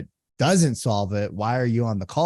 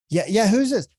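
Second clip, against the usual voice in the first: higher, sharp who's this; mock irritated homeowner; 8 semitones higher, 3 dB louder than usual. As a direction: act the irritated owner lightly. Upset homeowner answers.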